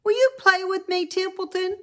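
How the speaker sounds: eager piglet. Acted. Wilbur asking the rat to play, high and hopeful. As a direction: beg sweetly to play. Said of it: "asked Wilbur".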